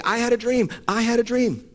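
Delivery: high, loud mocking "I had a dream"; mocking false prophets. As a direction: sneer at the dreamers. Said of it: "They say".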